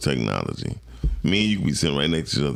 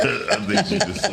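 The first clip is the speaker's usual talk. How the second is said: laughing. Real, not acted. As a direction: voice cracking high with laughter. Laughing mid-sentence.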